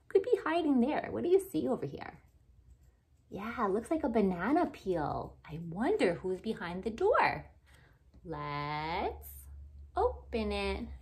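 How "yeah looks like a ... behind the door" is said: ordinary delivery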